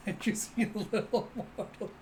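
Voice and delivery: high giggly words breaking up; real amusement bubbling over; talking through laughter. Real, not acted. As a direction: try to describe it while giggling. Narrator laughing at picture.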